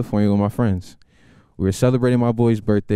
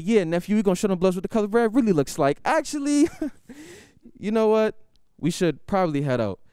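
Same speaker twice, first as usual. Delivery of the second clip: much higher eager uncle; gangster uncle; 10 semitones higher than usual. Retold or imitated. Uncle keen to fight then suggesting leaving, high. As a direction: relish it then back down. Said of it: "He said".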